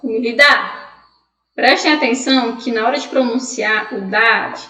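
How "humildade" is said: HUMILDADE said "umildá", last D dropped; Spanish soft ending. Shown as a demonstration. Teacher giving the Spanish form from Latin, soft ending. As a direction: stress the final "dá" of humildad. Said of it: "que em espanhol vai ser".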